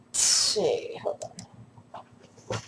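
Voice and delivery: whispered aside to himself; whispering. Real, not acted. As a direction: breathe it out under your breath. GM checking notes aside.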